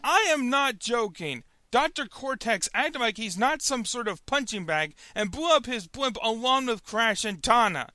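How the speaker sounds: ordinary talk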